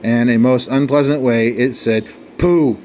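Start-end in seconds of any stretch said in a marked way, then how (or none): 2.4-2.8 contemptuous snorting "Pooh!"; sneering pooh. A narrator's reading. Camel scoffing at the lion, sneering snort. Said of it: "sniffed and sneered".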